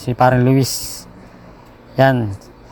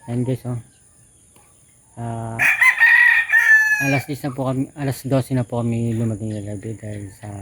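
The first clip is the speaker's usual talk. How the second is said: hushed voice; 2 semitones lower, 8 dB quieter than usual. Real, not acted. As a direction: keep it down, people sleeping. Early morning, others still asleep.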